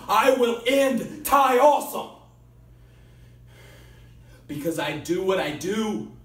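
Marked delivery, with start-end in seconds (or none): 0.1-2.2 high, loud roaring threat; shouted threat. Acted. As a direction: scream the threat at your rival. Climax of threat promo.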